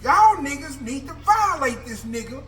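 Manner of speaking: rally the room against him; furious call-out. Retold or imitated. Inmate accusing the snitch to the dorm, high and furious.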